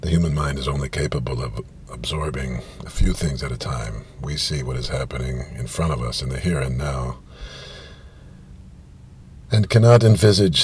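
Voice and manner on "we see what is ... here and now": usual speaking voice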